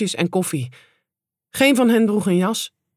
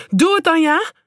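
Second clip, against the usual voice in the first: high-pitched dare with a questioning end; called out; 10 semitones higher, 4 dB louder than usual. A narrator's reading. Shop worker daring the lad once more, called out high. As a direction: push the challenge, voice well up. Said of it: "riep ze".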